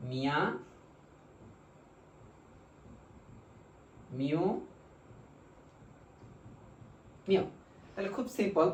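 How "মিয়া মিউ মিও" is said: নিয়া, নিউ, নিও each said alone after a long pause; slow syllable demo. Shown as a demonstration. Teacher modelling the new syllables, slow and deliberate. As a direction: model every syllable for students to repeat. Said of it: "pronunciation হবে".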